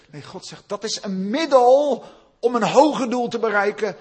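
loud, high, driving sermon voice; preaching at full force. Acted. Pastor stirring up the congregation, full-force preaching.

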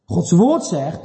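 ordinary talk, nothing marked

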